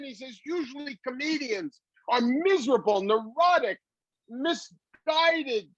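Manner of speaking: high put-on voice of a marvelling producer; imitating Gary Marshall. Gary Marshall lists miserable comedian traits to the comedian, high voiced.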